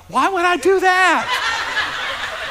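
high-pitched, drawn-out mock disbelief; incredulous protest. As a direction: balk at the idea in a high, slow voice. Balking at wife's cruise idea.

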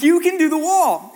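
high, loud pep-talk encouragement; bright-eyed coach's push; eager urging. Retold or imitated. Coaxing reluctant girl to climb.

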